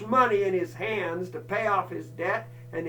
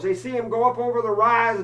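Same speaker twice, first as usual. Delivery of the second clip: ordinary talk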